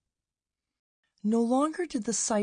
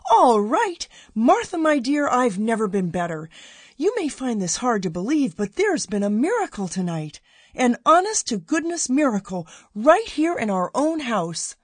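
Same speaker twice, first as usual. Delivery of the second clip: loud, higher, overjoyed announcement; joyful exclaiming; 4 semitones higher, 6 dB louder than usual. A narrator's reading. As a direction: spin her around and shout the good news. Emmett overjoyed spinning his wife.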